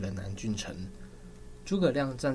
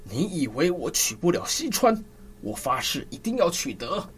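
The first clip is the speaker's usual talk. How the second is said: higher, louder vow through gritted teeth; 6 semitones higher, 4 dB louder than usual. Acted. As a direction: spit out the vow in fury. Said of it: "周瑜咬牙切齿地说".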